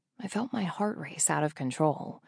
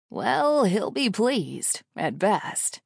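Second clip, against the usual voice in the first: chuckled jibe; 3 semitones higher, 3 dB louder, much slower than usual. A narrator's reading. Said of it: "Jason chuckles".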